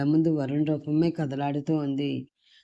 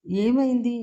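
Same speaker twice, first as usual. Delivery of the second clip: worried; 6 semitones higher, slower than usual. A narrator's reading. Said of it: "Varun కంగారుగా".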